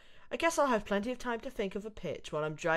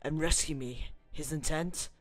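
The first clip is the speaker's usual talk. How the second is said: dramatic narration; 3 semitones lower, 5 dB louder than usual. Acted. Gamer narrating the intro as a dramatic story. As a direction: read it like a movie trailer intro.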